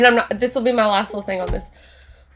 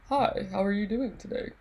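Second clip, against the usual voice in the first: much softer, lower polite guy; soft polite greeting; 3 semitones lower, 10 dB quieter than usual. Pretend. Teacher voicing a softer polite greeting. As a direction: say it gently and politely. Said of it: "maybe he says".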